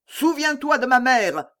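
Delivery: high vengeful cry; yelled. A narrator's reading. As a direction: cry it out while galloping past. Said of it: "hurla Mordaunt". (cri de vengeance, aigu)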